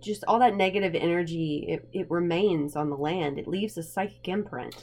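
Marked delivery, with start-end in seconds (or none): none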